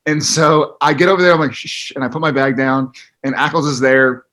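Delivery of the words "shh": soft hushing "shh"; retold shush. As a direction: shush someone quietly, as if sneaking up. Retold shushing while sneaking up.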